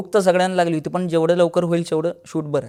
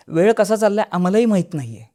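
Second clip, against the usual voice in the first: ordinary delivery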